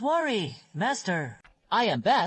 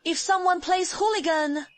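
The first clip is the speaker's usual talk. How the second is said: overacted anime dub; 8 semitones higher, 5 dB louder than usual. Master gives loud comic warning.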